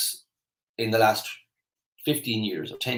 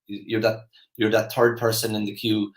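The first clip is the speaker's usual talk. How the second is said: usual speaking voice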